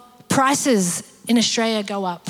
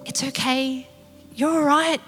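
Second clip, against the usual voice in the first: very calm. Acted. Jesus answering the panicked disciples, very calm. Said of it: "talking very calmly".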